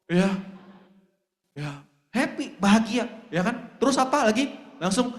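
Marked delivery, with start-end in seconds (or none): none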